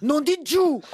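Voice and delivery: loud, high Belgian curse; Belgian accent. Shown as a demonstration. Topping her Belgian examples, exclaimed in Belgian accent. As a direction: belt out the oath with a thick Belgian accent. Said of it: "comme on dit en Belgique".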